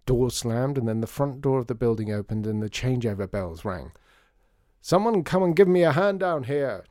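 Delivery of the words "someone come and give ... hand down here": loud high call up the stairs; shouting. A narrator's reading. Artie shouting up the stairwell for helpers, loud and high. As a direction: bellow for help up the stairwell. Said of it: "shouted Artie up the stairwell".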